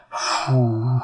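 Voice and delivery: long, low exhausted sigh; noisy sigh. A narrator's reading. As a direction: let out a heavy puff of breath. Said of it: "шумно вздохнул злодей".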